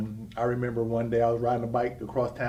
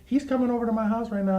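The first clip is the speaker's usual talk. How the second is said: put-on high, loud girlish excitement; excited friend; 12 semitones higher, 6 dB louder than usual. Girlfriend telling her about the recruiter, high and excited. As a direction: voice the girlfriend bubbling over.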